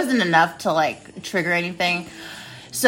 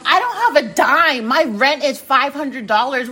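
pleading and higher, exasperated; exasperated quote; 6 semitones higher than usual. Retold or imitated. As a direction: protest to the lawyers you have nothing. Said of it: "and I'm like".

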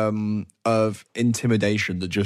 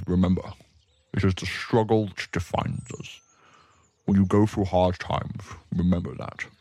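weighty parting counsel in a low voice; orc mother; 3 semitones lower than usual. Acted. Orc mother sends her child off, low and solemn.

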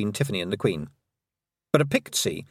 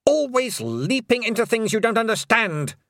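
hissing queen; 11 semitones higher than usual. Acted. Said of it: "she hissed".

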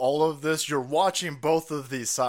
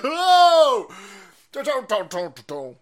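gleeful protest; 6 semitones higher, 9 dB louder, much slower than usual. Real, not acted. Mock protest.

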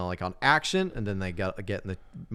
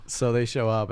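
ordinary delivery

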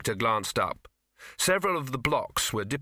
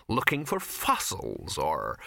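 slowing, sinking low on the last word; trailing off; 7 semitones lower, slower than usual. A narrator's reading. Ginger running out of excuses, trailing off. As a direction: let the sentence drop away unfinished. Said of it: "her voice trailed off".

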